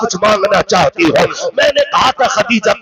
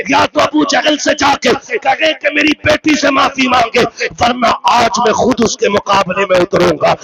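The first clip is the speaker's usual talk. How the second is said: ordinary delivery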